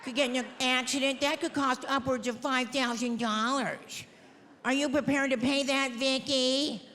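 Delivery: lightly voiced, patient lecturing official; prim shelter worker. Shelter staff lecturing Vicky about dog costs, lightly mocking. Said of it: "No, they're like".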